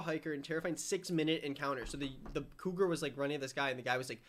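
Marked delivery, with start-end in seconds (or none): none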